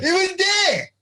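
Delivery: very high excited exclamation; thrilled, squealed confirmation; real excitement. Real, not acted. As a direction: confirm it at the top of your voice range. Teller thrilled at the story's climax.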